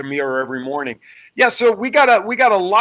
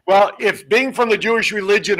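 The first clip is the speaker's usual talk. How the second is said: ordinary talk, nothing marked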